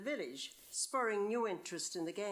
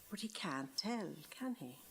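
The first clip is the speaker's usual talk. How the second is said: hushed whisper of worry; 3 semitones lower, 5 dB quieter than usual. Acted. Girl whispering her fear the priest will know. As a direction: breathe it as a nervous secret. Anxious about confession secret.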